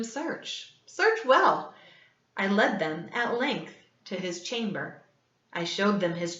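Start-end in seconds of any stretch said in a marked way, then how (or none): 0.0-1.7 taunting. A narrator's reading. Killer daring the officers to search well. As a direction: dare them to look.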